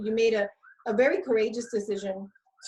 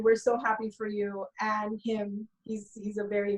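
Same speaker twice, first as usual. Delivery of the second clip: usual speaking voice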